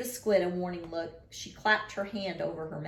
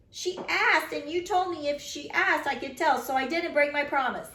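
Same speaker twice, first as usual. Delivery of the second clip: put-on whiny little girl; 8 semitones higher, 3 dB louder than usual. Little sister protesting.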